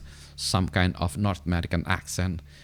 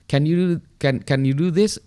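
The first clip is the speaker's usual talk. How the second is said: raised, louder Singaporean English question; Singlish voice; 7 semitones higher, 6 dB louder than usual. Shown as a demonstration. A Singaporean asking if you can do something, in Singlish. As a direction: play a Singaporean asking a favour. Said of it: "they use the word lah".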